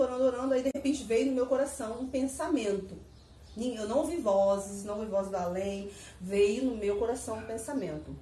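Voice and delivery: ordinary talk, nothing marked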